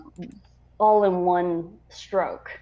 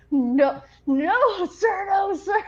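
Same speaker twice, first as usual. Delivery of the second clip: high, rising panicked no sir; flustered denial; 12 semitones higher, slower than usual. Retold or imitated. Recruit denying crush to officer, high and flustered. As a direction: squeak out the denials. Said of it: "And I'm like".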